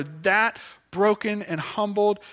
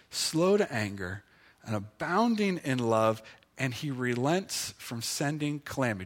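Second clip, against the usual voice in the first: soft, low, measured Bible reading; hushed, lower-pitched recital of scripture; 6 semitones lower, 8 dB quieter than usual. Acted. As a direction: read the verse quietly and reverently. Reads the verse aloud.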